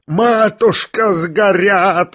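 high, drawn-out pleading cry; acted wail. A narrator's reading. Lebedev pleads for the burning money, wailing. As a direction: wail slowly, stretching each word. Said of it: "вопил Лебедев".

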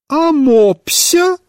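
exasperated high cry; irritated shout. A narrator's reading. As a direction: shout it in disbelief. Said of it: "выкрикнула с раздражением".

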